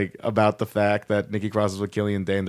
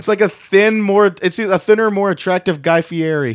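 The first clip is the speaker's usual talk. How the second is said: amused excitement; 9 semitones higher, 6 dB louder than usual. Real, not acted. Excited comparison, pitched up loud.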